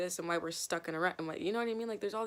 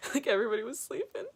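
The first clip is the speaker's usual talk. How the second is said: laughing; 3 semitones higher, 5 dB louder than usual. Real, not acted. Laughing through the text story.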